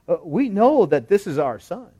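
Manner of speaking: lightly acted wary parents; cautious parents. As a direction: answer as parents tiptoeing round trouble. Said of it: "You can see them tiptoeing here".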